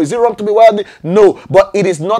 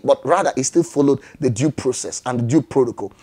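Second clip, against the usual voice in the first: usual speaking voice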